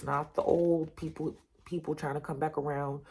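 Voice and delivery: ordinary talk, nothing marked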